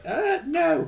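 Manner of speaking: put-on high drawn-out kid's no; child's denial. Dad imitating his son denying he needs a wee, high.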